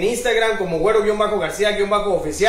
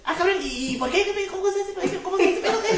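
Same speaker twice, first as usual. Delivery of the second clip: much higher, squeaky mock voice; animal in the joke; 11 semitones higher, 6 dB quieter, faster than usual. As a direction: fire off the questions like a curious critter. (voz aguda de animalito curioso)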